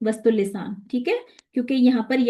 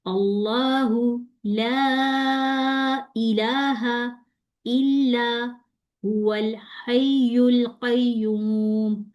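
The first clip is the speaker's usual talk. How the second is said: चढ़ती आवाज़ on हय्युल, tongue not raised; slow recitation, raised voice on हय्युल; much slower than usual. Shown as a demonstration. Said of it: "हय्युल पे मैंने आवाज को उठाया".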